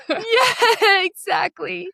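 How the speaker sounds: high-pitched, through laughter; real laugh running into the words; laughing agreement. Real, not acted. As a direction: agree while breaking into giggles. Both laugh about needing two parts.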